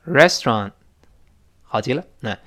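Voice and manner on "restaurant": "Restaurant" as quick "res-tron"; two syllables in "Restaurant", final t unreleased; fast reduced form. Shown as a demonstration. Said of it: "跟老师再读一遍".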